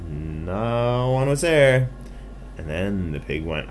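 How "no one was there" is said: high, loud, stretched No; drawn-out searching. Acted. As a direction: draw out the empty result for suspense. Pig searching, finding nobody.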